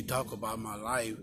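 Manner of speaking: ordinary talk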